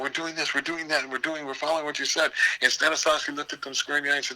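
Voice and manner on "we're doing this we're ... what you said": high, eager, fast reporting; eager students. Retold or imitated. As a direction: sound like keen pupils seeking approval. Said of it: "they told him".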